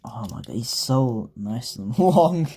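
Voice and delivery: normal talking voice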